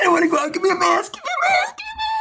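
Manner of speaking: mock panicked person. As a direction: whine for a mask like a frightened kid. Mocking scared people wanting masks.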